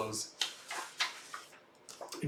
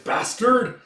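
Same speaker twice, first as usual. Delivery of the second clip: high, raised acted shout; angry curse; 8 semitones higher, 4 dB louder than usual. Warrior curses the archer, angry shout. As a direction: spit out an insult in battle.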